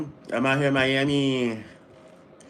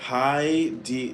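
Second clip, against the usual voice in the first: ordinary delivery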